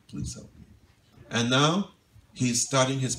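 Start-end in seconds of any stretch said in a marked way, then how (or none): 0.1-0.6 the plea repeated as a breathy whisper. Retold or imitated. Nimai's plea to Gadai, whispered and fading. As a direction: let the plea fade to a whisper.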